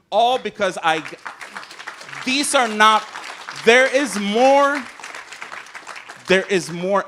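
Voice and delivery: loud and emphatic, close to a shout; impassioned outburst. Real, not acted. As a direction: push it out in rising anger. Impassioned outburst about family.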